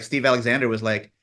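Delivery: ordinary talk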